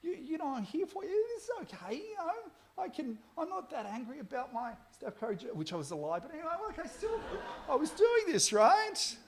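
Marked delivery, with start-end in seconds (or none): 0.0-5.5 soft, higher, tender comfort; gentle reassurance. Retold or imitated. Dad comforting his crying daughter, softly reassuring. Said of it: "she was crying at this point". 6.9-9.2 laughing. Real, not acted.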